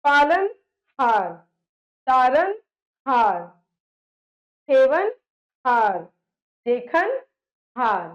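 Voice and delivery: teacher stressing the suffix हार; emphatic word list. Shown as a demonstration. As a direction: call out root and suffix separately. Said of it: "हार प्रत्यय है".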